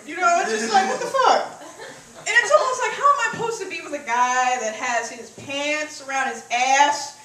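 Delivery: ordinary talk, nothing marked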